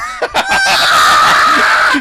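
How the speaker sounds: very high, loud screech; mock enraged scream. Little girl exploding at the nickname, screamed in imitation. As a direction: imitate a child's power-up scream. Girl's reaction, acted scream.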